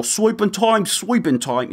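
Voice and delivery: gutter-snipe London accent, badly faked; bad Cockney accent. Mocking the wrong British accent to have, in hammy fake Cockney.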